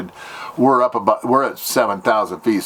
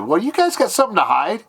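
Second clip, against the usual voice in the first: suspicious jab; 10 semitones higher than usual. Retold or imitated. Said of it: "I almost said".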